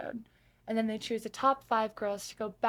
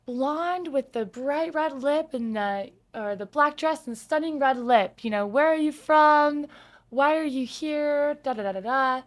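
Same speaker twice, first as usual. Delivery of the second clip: [name], lightly voiced; 5 semitones higher, 8 dB louder than usual. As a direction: lightly imitate the host singling her out, admiring. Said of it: "she goes".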